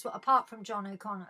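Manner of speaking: normal talking voice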